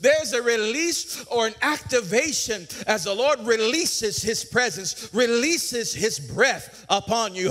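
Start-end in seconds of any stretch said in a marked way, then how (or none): none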